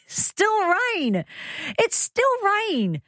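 high drawn-out wail of protest, playfully insistent; mock protest. Host defending rain forecast to husband, mock protest. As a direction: whine it high and stretch it out.

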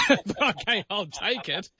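talking through laughter; amused, laughing as he concedes. Real, not acted. Host laughs at awkward misunderstanding.

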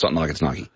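first Nike like bike, second Nike NY-kee; corrected pronunciation. Shown as a demonstration. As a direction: correct one-syllable Nike to two-syllable Nike. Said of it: "everyone said Nike until Nike came out".

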